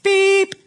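high short beep; imitated scanner beep. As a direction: chirp like a checkout scanner. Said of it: "you'll hear this".

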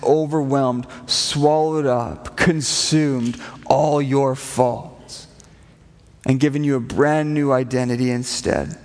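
very slow, each phrase set apart and weighed; slow solemn emphasis. Acted. Pastor to congregation on faults forgiven, slow and solemn. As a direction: let every word land, slowly.

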